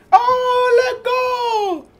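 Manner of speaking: high shouted party cry; imitating the crowd partying outside; mock party shout. Loud crowd heard from his room.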